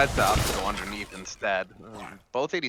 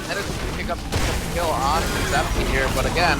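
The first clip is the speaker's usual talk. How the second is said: higher, louder hype casting; play-by-play; 4 semitones higher, 4 dB louder than usual. Acted. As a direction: call the fight as it unfolds. Live play-by-play of a team fight.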